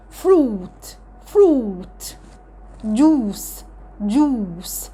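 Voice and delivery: "فروت، جوس" with a long, falling "oo"; long double-O. Shown as a demonstration. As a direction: stretch the middle vowel in each "فروت" and "جوس". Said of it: "تتنطق كانها اتنين او". (نطق "فروت" و"جوس" بواو ممدودة)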